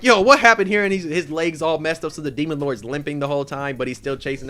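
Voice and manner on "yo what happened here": higher and louder, caught off guard; startled. Retold or imitated. Demon Lord reacting to the dynamite blast, loud and startled.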